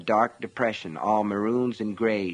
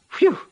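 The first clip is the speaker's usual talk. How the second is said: disgusted phew; 8 dB louder than usual. A narrator's reading. Foxtail complaining of the smell.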